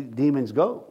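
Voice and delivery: normal talking voice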